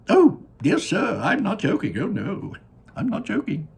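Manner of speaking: Willy Wonka voice. Acted. Wonka gazing down at his factory, high and delighted. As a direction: sing it out like a proud candy maker.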